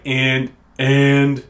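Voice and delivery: a light Trump stutter on and; a hint of Trump repeating and; terrible Donald Trump impression. Mocking how Trump says and, lightly voiced. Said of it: "terrible Donald Trump impression".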